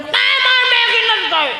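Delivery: put-on very high, bratty child refusal; mock child tantrum; whining child. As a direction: squeal it like a stubborn little kid. Acts child refusing food.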